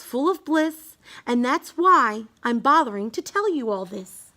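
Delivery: usual speaking voice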